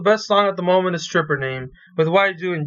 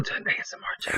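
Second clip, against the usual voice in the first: voiceless, very soft whisper; ASMR whisper; 13 dB quieter, much faster than usual. Pretend. Co-host announcing the switch to an ASMR channel, whispering. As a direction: play the hushed ASMR host. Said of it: "we're gonna talk like this".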